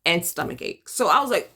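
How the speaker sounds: ordinary talk, nothing marked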